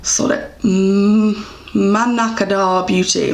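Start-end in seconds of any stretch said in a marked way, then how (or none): none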